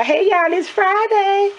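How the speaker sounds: high drawn-out greeting. Pretend. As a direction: stretch it out high and happy. Playful drawn-out Friday greeting.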